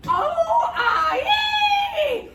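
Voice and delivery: very high, loud yelping whoop; excited. Acted. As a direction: squeal with over-the-top excitement. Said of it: "the sheriff was so excited".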